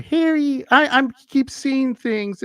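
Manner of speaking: high-pitched, slow patient voice; big man with a little high voice; small high voice. Retold or imitated. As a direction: call out in a tiny high voice. Acting the small-voiced huge patient.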